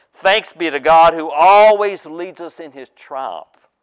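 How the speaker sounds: ringing out the praise slowly; triumphant cry. Acted. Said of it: "he cries out with this triumphant".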